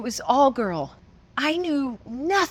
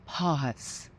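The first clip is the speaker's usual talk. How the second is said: quiet; 6 semitones lower, 6 dB quieter than usual. Retold or imitated. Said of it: "And she said".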